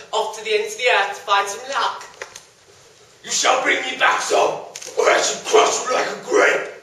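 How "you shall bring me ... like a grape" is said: loud, menacing monster voice; booming arrogant alien overlord. Acted. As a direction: threaten to crush him. Monster threatens young man.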